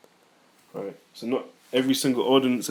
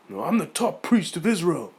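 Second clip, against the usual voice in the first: high-pitched mocking boast; mock boasting priest; 6 semitones higher than usual. Teacher parodying a man claiming priesthood, mocking. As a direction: put on a high, self-important priest voice.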